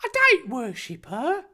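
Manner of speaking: high, prim put-on boy voice; high-pitched, affronted character; scandalised Harry. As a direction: deny it indignantly, pitched high.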